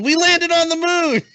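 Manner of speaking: high put-on voice; mock reply. Imitating the friend's reply, high goofy voice. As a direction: sell it like breaking news. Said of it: "he replied to us going".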